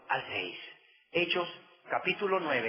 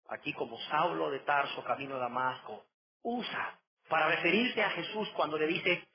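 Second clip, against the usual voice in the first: usual speaking voice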